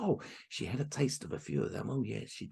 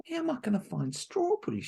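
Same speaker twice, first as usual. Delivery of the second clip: put-on dismayed girl; 13 semitones higher than usual. Marushka protests.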